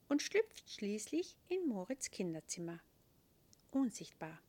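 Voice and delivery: usual speaking voice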